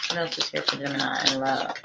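hushed musing. Real, not acted. Reader quietly asking the cards about Gemini love, hushed. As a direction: drop to a murmur as you shuffle. Tarot reader repeats her question.

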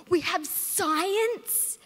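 gleeful drawn-out cheer; excited. Pretend. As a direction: stretch it out like the best news ever. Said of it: "that one class where you're like".

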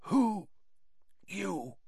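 high then very soft question; whispered. Acted. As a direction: fade to a thread of voice. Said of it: "he whispered".

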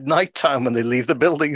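normal talking voice

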